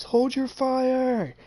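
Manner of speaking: high, slow, loud yelled command; shouted order. Retold or imitated. Player shouting to his teammates across the firefight, high slow yell. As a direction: bellow it down the hill at your team.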